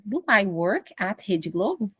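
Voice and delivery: musing self-question. Shown as a demonstration. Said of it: "Eu posso me perguntar".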